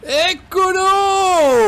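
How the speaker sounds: long drawn-out shout. Real, not acted. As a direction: call it out long and loud. Calling out to player in game.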